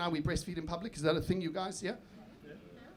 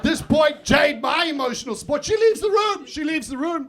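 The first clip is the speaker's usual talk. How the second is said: exasperated shout; 8 semitones higher, 6 dB louder than usual. Real, not acted. Comic outrage at partner leaving.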